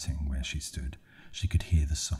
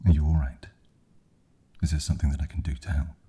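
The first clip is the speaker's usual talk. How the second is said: loud, urgent offer of help; concerned question; 8 dB louder, faster than usual. A narrator's reading. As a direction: ask loudly, then hurry into the offer. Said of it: "she asked".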